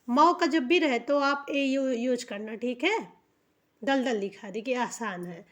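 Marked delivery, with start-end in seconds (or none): none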